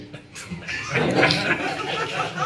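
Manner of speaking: a high, airless burst of laughter; breathy laugh. Real, not acted. Laughs at the paid imam.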